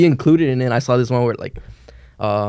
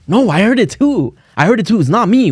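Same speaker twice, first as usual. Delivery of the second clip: high, insistent mock innocence; mock protest; 7 semitones higher than usual. Prankster insisting to workers, high mock protest. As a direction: play the wronged innocent.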